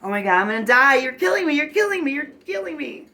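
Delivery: high, loud drama-queen wailing; hammed-up drama queen in hysterics; hysterical emotional outburst. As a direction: act out an over-the-top meltdown, pitch climbing and held high. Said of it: "having those emotional outbursts".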